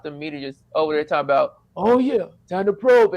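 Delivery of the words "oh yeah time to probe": hammed-up alien line; a goofy little alien voice. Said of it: "see the alien voice".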